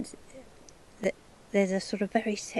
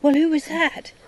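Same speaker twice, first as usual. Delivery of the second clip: sudden high, loud bewildered exclamation; startled thought; 11 semitones higher, 10 dB louder than usual. Retold or imitated. Stranger kisses you on both cheeks, so startled high exclamation. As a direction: blurt it out, baffled.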